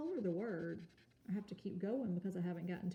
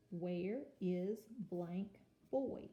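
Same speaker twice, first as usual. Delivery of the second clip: slow reading; much slower than usual. Shown as a demonstration. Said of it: "slow reading with blank".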